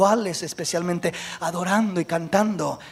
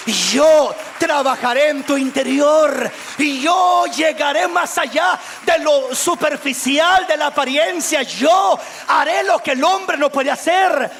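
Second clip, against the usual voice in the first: loud, higher divine proclamation; God proclaiming; 7 semitones higher, 4 dB louder than usual. Acted. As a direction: proclaim it with God's authority. Dios habla con fuerza. (Dios proclama, fuerte y más agudo)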